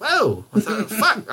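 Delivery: high startled whoa; surprised. Retold or imitated. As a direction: react like caught off guard. Shocked by third child question.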